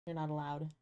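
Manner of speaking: soft, low teasing warning; a hushed playful threat to chat; murmured warning. Pretend. Third repeat, dropped to a murmur.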